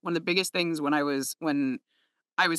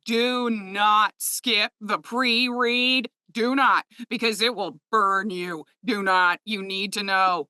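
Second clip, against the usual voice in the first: very slow, each word hit hard; giant-caps emphasis; 3 semitones higher, much slower than usual. Retold or imitated. Author warning narrators to pre-read, giant-caps emphasis. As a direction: spell it out like a warning in capitals. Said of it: "like, in giant caps".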